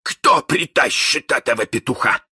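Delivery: high, loud roaring captain; thundering. Acted. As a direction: boom it across the deck. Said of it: "загремел он".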